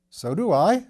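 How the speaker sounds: firm, hearty reassurance; stoutly. A narrator's reading. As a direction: say it with sturdy conviction. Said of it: "said Mister Bunter stoutly".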